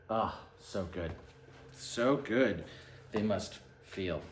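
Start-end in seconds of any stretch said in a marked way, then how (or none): none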